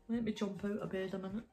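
ordinary delivery, nothing marked

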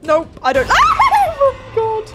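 high loud panicked shriek; terrified shriek. Real, not acted. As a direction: yelp then laugh in panic. Jump scare.

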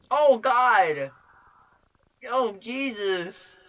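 loud, high, dragged-out gasp of horror; shocked outcry. Real, not acted. Gamer horrified by the scene, loud slow outcry. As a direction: let the horror pull the words out slowly.

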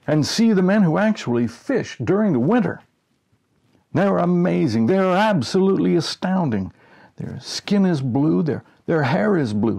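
loud, high sideshow barker hawking the freaks; carnival barker. Pretend. Narrator pretending to pitch winter fishermen as a sideshow, hammed-up barker. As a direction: bark it out like a circus showman selling tickets.

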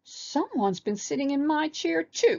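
high, upset little bear voice; high childish protest; crying baby bear. A narrator's reading. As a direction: cry out like a small cub. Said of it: "cried Baby Bear".

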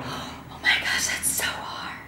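soft unvoiced whisper. Real, not acted. Answering the favorite-animal question, hushed whisper. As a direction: breathe it out as a hushed aside. Whispering over a hard choice.

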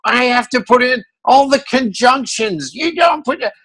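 imitating an indignant grumble, pitched high; exasperated Tom. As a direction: whine like a fed-up editor, way up high. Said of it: "he goes".

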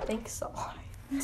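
slow, very soft whisper; hushed aside, all breath. Real, not acted. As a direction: whisper a drawn-out thank-you. Soft aside thanking a viewer.

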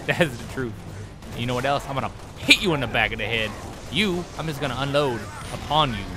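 ordinary delivery

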